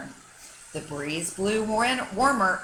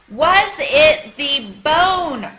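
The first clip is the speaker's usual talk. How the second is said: loud, slow, drawn-out holler; 7 semitones higher, 10 dB louder, much slower than usual. A narrator's reading. Dog hollering at the silent bird, long loud holler. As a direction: stretch it out and holler. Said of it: "Rocket hollered".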